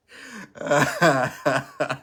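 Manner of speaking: loud laughter running on; helpless real laughter; long laugh. Real, not acted. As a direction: keep cracking up. Keeps laughing at own remark.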